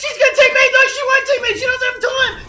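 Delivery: screaming play-by-play, voice cracking high; excited shouting. Real, not acted. Caster yelling as the player clutches the round. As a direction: lose it over the clutch, yelling.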